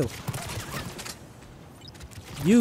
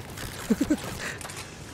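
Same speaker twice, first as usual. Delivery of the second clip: airy, voiceless chuckling; amused laugh; 9 semitones higher, 6 dB quieter than usual. Real, not acted. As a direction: laugh as they drop. Enemies die as predicted.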